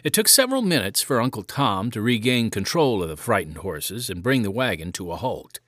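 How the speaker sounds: plain reading voice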